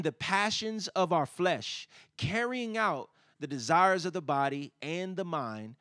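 high, loud, emphatic preacher delivery; preaching. Real, not acted.

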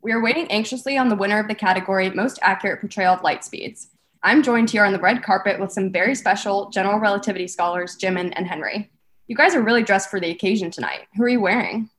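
loud, bubbly red carpet host; gushing red carpet chatter. Acted. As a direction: gush like an awards show host.

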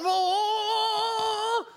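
long, very high "oh" held out; mock panicked wail. Anxious believer as world falls apart, mock high wail.

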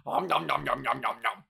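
gobbling noises in someone's face; smug nom nom eating sounds; taunting chew sounds while eating the lunch; mock chomping. Mimics eating the free lunch.

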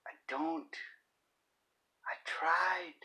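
breathy whispered admission, almost to himself; whispered confession. Acted. Whispering to viewers that he never games. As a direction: whisper it like a secret.

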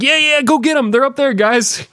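very high squeaky urging; hammed-up high-pitched encouragement; mock cheering on. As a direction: egg them on in a high put-on voice. Plays escapee egging Germans on.

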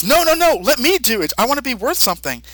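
high, eager pleading. Retold or imitated. Kaioshin begging to sacrifice himself, high and pleading. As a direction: beg to be allowed, high and fast. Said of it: "Kaioshin says".

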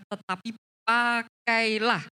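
"pakailah" loud, high and drawn out syllable by syllable; stress on pakailah. Shown as a demonstration. Preacher points back to the verse, "pakailah" stressed and drawn out. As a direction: stretch "pakailah" slowly and forcefully. Re-quotes key words of verse.

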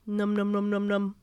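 steady monotone gobbling; cartoon eating sound; munching noise. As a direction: chomp through it in a quick chain. Voicing spiders devouring the spray.

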